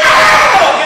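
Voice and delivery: sudden high loud yelp; mock pained kid yelp; kid's yelp. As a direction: cry out like a smacked kid. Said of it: "And the kid goes".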